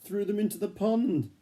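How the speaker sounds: high, loud triumphant punchline; triumphant climax. A narrator's reading. Reader landing the bullies' fate for the child, high and triumphant. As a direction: land the climax with glee.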